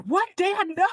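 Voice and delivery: high-pitched mock dismay; retold startled protest. Daughter objects to dad's sudden exit, acted startled protest. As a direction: protest in a high, taken-aback voice.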